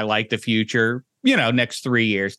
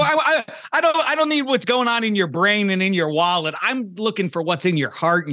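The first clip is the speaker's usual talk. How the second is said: excited; 9 semitones higher than usual. Real, not acted. Host urging guest, heated.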